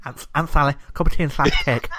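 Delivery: put-on children's TV scarecrow voice; Worzel Gummidge. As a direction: do a comic scarecrow begging for tea. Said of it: "his Words of Gummidge voice".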